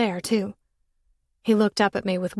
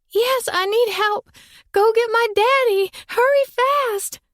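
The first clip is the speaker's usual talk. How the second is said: put-on high, loud, slow little-girl plea; frightened little girl; 5 dB louder than usual. Girl in a tree begging boy for help, high-pitched. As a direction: beg the boy to fetch daddy.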